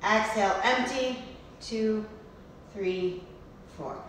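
slow, soft counting of breaths. Shown as a demonstration. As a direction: count the breaths slowly and gently. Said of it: "leads breath counting for child".

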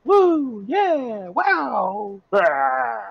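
high, drawn-out whoops; cheering crowd. Acted. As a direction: play a whole excited crowd cheering.

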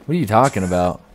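ordinary delivery

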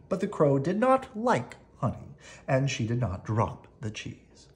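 plain reading voice

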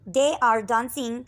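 very slow, clear reading; dictation pace. Shown as a demonstration. Teacher reading an example sentence, very slow. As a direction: read the English word by word. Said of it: "वो नाच रहे हैं".

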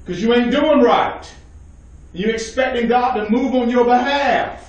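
high, confrontational preacher's voice; full-force preaching. Real, not acted.

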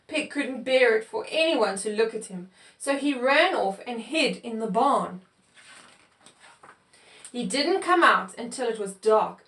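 ordinary delivery

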